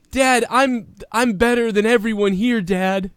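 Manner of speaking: put-on high whiny kid pleading. Shown as a demonstration. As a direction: whine it like a spoiled son. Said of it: "in a whiny voice".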